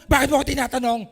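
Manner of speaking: loud indignant snap of a touchy person; mock angry retort. Preacher mimics a hot-tempered person answering a simple question, loud and indignant. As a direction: snap back like an irritable stranger who hates questions. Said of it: "Magtatanong ka pa lang".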